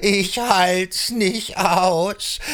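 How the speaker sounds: slow, drawn-out groan of despair; exasperated. Acted. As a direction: stretch every word, worn out. Goat exasperated.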